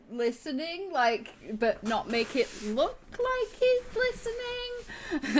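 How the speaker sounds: high drawn-out mock-innocent voice; feigned innocence. Game master showing Tyne edging closer to eavesdrop, high feigned innocence. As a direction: stretch it out high, playing at not listening.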